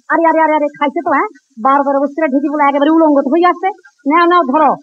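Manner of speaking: a priest startled and scolding; shocked outburst. Acted. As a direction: raise the voice in shock. Priest shocked at naked boy.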